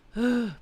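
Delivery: heavy, audible sigh; loud sigh. Real, not acted. Loud sigh mid-stream.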